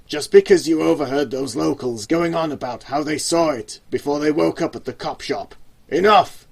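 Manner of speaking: loud, furious boss voice; the gang boss yells at his partner; angry yell. Acted. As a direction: rage at the old man. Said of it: "V stood up and yelled".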